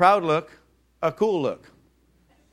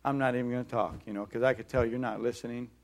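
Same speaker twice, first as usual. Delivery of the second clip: resigned inner voice; 5 semitones lower, 8 dB quieter than usual. Retold or imitated. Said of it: "One response is".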